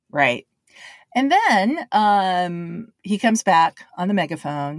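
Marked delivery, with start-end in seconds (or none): none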